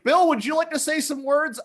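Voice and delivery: laughing mock emcee; mock host, laughing. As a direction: introduce Bill while cracking up. Mock host at Clinton event.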